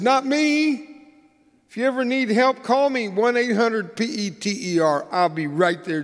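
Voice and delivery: high, loud, cocky boast; Peter. Acted. Disciple assuring Jesus of his loyalty, loudly overconfident. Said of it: "ask Peter about it".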